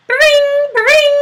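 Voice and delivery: high ringing bring bring; doorbell ring. A narrator's reading. Narrator mimics the ringing doorbell, high and loud.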